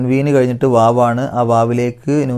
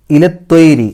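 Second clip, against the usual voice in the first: louder, higher chanted word; Quran recitation; 4 semitones higher, 6 dB louder, slower than usual. Shown as a demonstration. Teacher recites the word with the light ra. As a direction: chant ഇലത്തൊയ്രി with a clear light ri. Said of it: "രി എന്നുള്ള ഉച്ചാരണം കൊടുക്കുക".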